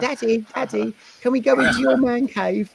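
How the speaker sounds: put-on high, eager small-child voice; little boy voice. Dad mimicking his son asking about the man cave in a high child's voice. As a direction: pitch up high like a little British boy begging. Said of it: "this little boy".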